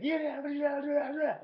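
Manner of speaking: high, loud sing-song yeah yeah; high mock agreeing. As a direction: parrot along like a pushover. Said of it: "where it's like".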